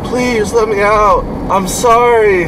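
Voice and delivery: high, slow, pleading whine of begging parents; mock begging. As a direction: whimper it out like caged parents begging. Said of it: "crying and".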